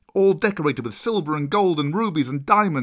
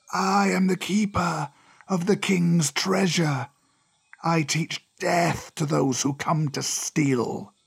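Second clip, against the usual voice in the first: slow, drawn-out serpent voice, pitched up; menacing old cobra; 5 semitones higher, slower than usual. Acted. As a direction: proclaim it like an ancient treasure guardian. Cobra figure in the story.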